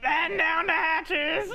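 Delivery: slow, loud hammed-up pirate cry; overdone first-try pirate; pirate voice attempt. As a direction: belt out the pirate line. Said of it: "we both did the voice".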